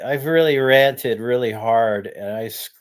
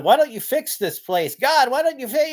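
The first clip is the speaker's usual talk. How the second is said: high, raised cry at God; yelling at God; 12 semitones higher than usual. Retold or imitated. Man yelling at God to fix the world, high pleading cry. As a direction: demand answers from the heavens. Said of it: "And I'm yelling at God".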